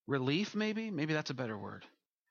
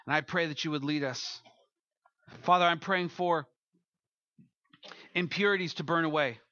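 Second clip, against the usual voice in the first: prayer; 6 semitones higher, 5 dB louder than usual. Acted. Pastor praying over the standing congregation, earnest prayer.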